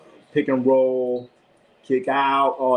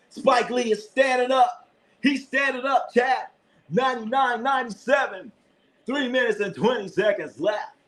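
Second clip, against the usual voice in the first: excited; 9 semitones higher than usual. Real, not acted. Fan calling the Knicks comeback, much higher and excited. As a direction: call it like the Garden is erupting.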